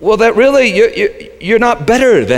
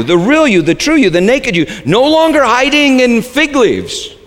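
ordinary talk

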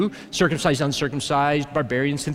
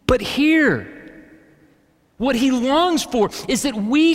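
higher, louder preaching voice, slowed on key lines; impassioned preaching; 6 semitones higher, 4 dB louder than usual. Acted. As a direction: preach it out with full force to the room.

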